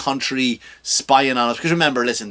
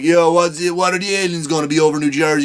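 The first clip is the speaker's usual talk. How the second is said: louder, fast Jersey Italian bluster; mock Jersey Italian; 3 dB louder, faster than usual. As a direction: lightly ham up a Jersey guy. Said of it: "just like".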